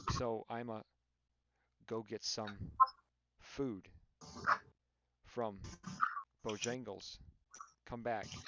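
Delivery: halting, long gaps after every word or two; mock broken English. Mocking a friend's typing aloud, in halting fragments. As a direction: chop the sentence into tiny fragments with pauses. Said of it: "started to mock him by speaking in broken English".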